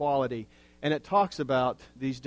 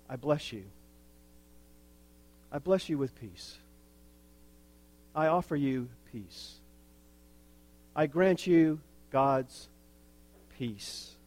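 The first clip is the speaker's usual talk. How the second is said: gentle blessing. Pretend. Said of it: "why don't you say".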